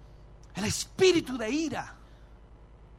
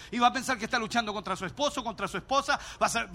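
normal talking voice

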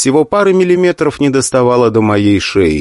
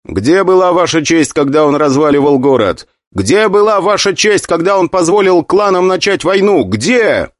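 angry; 6 semitones higher than usual. Acted. Hero accuses the council angrily.

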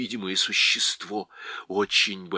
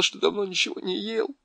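ordinary delivery

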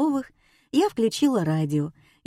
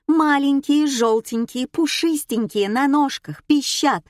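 high, sing-out listing of clues; coaxing hint; 7 semitones higher, 4 dB louder than usual. A narrator's reading. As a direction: spell out clues as if to a child. Host spelling out hint to dullard. (подсказка как ребёнку)

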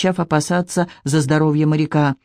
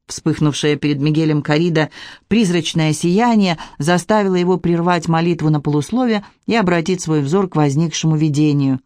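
plain reading voice

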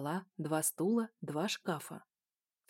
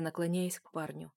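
usual speaking voice

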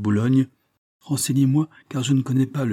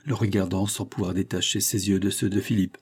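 plain reading voice

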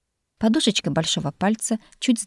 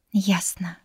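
whispered, lost and small; lost mumble. Acted. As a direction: breathe it out, at a loss. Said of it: "потерянно пробормотала я". (растерянный шёпот)

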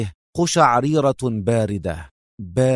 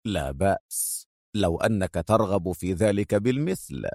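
low, soft, dry mockery; cool sarcastic calm; 5 semitones lower, 6 dB quieter than usual. A narrator's reading. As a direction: taunt him quietly. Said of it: "يقول ساخرا".

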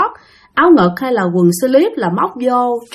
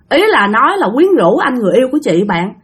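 ordinary talk

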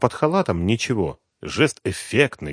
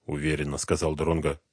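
plain reading voice

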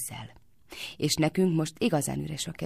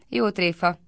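a louder cry of disbelief; called out, louder; 2 semitones higher, 5 dB louder than usual. A narrator's reading. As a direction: call it out as a joke. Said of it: "kiáltotta Lalita". (hangosabban, hitetlenkedve)

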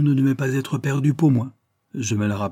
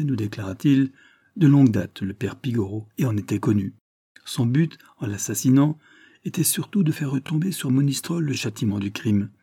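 plain reading voice

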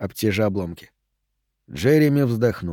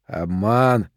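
drawn-out, mildly suspicious accusation; a touch of scornful disbelief; distrustful; 3 semitones lower than usual. A narrator's reading. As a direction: say it as if seeing through a trick. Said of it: "недоверчиво скалясь".

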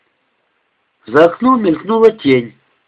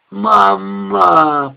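a mild moan, higher, for mother; 6 semitones higher than usual. Acted. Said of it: "простонала Люсенька".

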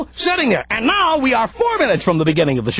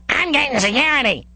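Kenny voice. Retold or imitated. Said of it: "start sounding like Kenny on South Park".

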